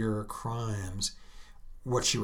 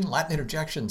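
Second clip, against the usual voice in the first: ordinary talk, nothing marked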